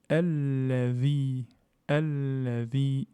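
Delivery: Alladhi with the long a held two beats; slow recitation. Shown as a demonstration. Teacher demonstrating the natural lengthening in Alladhi. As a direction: stretch the long a of Alladhi slowly. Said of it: "petit allongement naturel".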